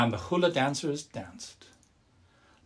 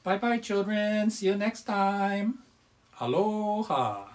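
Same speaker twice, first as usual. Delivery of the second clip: put-on higher, cheerful farewell to kids; friendly bear talking to children; 4 semitones higher than usual. The Bodhi bear says goodbye to the children, high and cheerful. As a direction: bid the children goodbye brightly.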